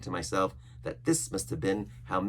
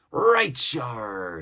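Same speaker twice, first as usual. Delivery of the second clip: gruff pirate; 4 semitones higher, 8 dB louder, much slower than usual. A narrator's reading. Silver voiced.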